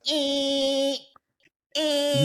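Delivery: very high squealing screech of a machine; high mechanical squeal; imitated machine squeal. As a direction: mimic the brakes shrieking. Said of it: "it goes".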